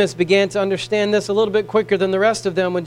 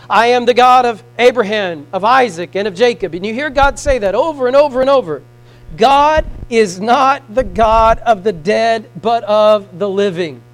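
preaching; 6 dB louder than usual. Acted. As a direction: drive each name home to the church. Preaching at full force.

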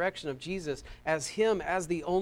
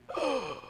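sharp, higher gasp of pretend shock; mock gasp; 7 semitones higher, 4 dB quieter than usual. Preacher mocking social media persecution, mock shocked gasp. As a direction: gasp as if scandalized.

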